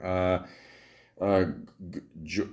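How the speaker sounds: usual speaking voice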